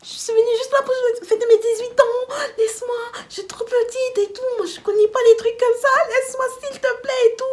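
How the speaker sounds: high, loud, squealed sobbing imitation; whiny crying plea. Saying she is too little, whiny crying plea. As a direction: plead like a tiny wailing child. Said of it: "je commençais à parler comme ça".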